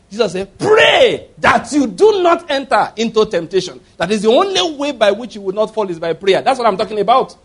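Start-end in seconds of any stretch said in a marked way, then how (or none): 0.6-3.8 loud, high, slow exhortation; shouted command. Acted. Jesus warning disciples against falling into temptation, shouted command. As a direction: cry out the first word, then drive the warning home.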